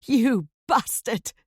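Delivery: slow, loud furious curse. A narrator's reading. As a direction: spit the insult out slowly. Wife screaming at husband.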